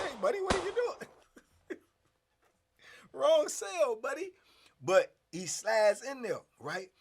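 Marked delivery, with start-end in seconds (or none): 0.0-1.8 much higher, thrown-off voice of a startled inmate. Retold or imitated. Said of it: "He was like". 3.1-4.3 higher, louder mock protest; a touch of put-out surprise, pitched up. Startled inmate protests intruder.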